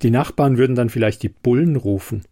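ordinary delivery, nothing marked

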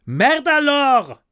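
high, loud, drawn-out curse; angry yell back. Acted. Narrator curses at neighbour through wall, long angry yell. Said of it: "schrie ich zurück".

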